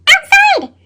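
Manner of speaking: high, loud joyful outside; excited. Acted. Potato proudly telling he now goes outside, high and loud. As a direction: burst out with the word outside.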